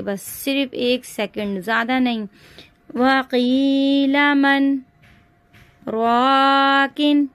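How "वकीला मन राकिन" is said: slow chant, voice stopped dead between मन and राकिन; sakta pause. Shown as a demonstration. Teacher shows students sakta, slow chant halted after मन. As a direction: stretch वकीला, halt briefly after मन, then a long राकिन. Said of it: "आवाज़ को रोकना है".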